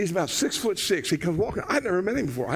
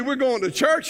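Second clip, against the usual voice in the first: raised pitch, disbelieving question; incredulous echo; 8 semitones higher, 3 dB louder than usual. Acted. Husband questioning his wife's church plan, incredulous. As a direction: throw it back as an incredulous question.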